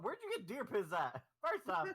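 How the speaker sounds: loud, high-pitched indignant protest; hammed-up indignant rant; mock outrage. Imagines protesting the deer-piss prank.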